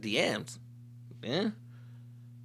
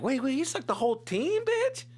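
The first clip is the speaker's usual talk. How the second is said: high, rising disbelief; incredulous outrage; 12 semitones higher than usual. Acted. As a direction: squeal it out in shocked disbelief. Acts out finding out her secret.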